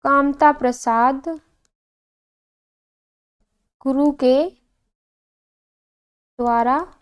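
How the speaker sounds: word by word with long pauses; halting, drawn-out dictation pace; slow dictation. Real, not acted. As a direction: dictate while writing it out. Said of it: "यहाँ लिखते हैं हम लोग".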